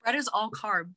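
talking while chewing food; mouth full. Real, not acted. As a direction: speak through a mouthful. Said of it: "I'm eating".

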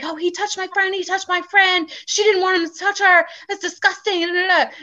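re-enacted girl's high-pitched grievance; imitated indignant complaint. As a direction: complain shrilly on someone else's behalf.

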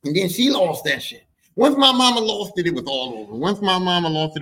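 normal talking voice

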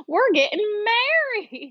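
high, slow country drawl breaking into laughter; hammed-up country announcement, stretched out; giggly, overdone country twang; country accent. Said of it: "that's how they talk".

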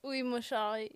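mock French. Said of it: "That's not too bad".

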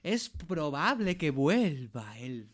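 put-on slow, drawn-out Eastern European accent. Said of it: "con acento de Europa del Este".

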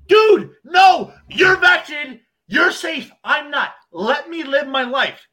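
high, loud exasperated yell; high, loud mocking outburst; mock shouted plea. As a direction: shout it like a fed-up guy begging to be left alone. Mock rant as unvaccinated person.

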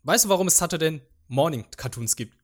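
ordinary talk

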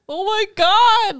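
high, slow, drawn-out oh my God, half-acted; retold panicked gasp. Telling her partner how she panicked in the waiting room, mock panic. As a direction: replay the worried moment, pitch jumping up.

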